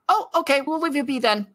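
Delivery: bow out cheerfully in a high voice; high, light voice for a student guide leaving a teacher be; chirpy npc. Acted. GM voices Layla backing off.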